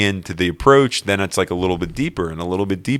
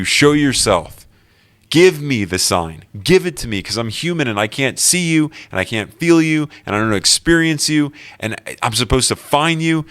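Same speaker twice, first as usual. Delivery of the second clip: raised, higher-pitched plea to God; yelling out; 6 semitones higher, 3 dB louder than usual. Retold or imitated. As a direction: cry out a challenge to heaven. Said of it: "I'm yelling out".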